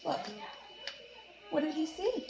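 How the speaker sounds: ordinary delivery, nothing marked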